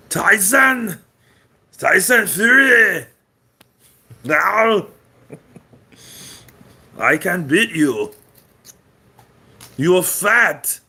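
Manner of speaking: put-on high, loud Austrian-accent bodybuilder taunt; Arnold Schwarzenegger. Austrian bodybuilder shouting down Tyson Fury, loud accent. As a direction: bellow like an Austrian action star. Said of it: "Great Arnold Schwarzenegger".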